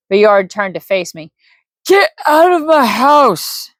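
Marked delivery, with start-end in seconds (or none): 1.9-3.7 put-on high, slow, drunken bellow; drunk man's yell. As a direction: throw the intruder out, slurring high. Drunk man orders him out.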